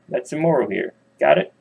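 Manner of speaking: ordinary delivery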